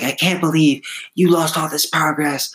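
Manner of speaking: hammed-up outraged rant; furious wife. Rancher's wife blaming him for lost progress, mock furious. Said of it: "she's just like".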